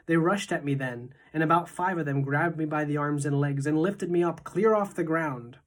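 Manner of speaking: ordinary delivery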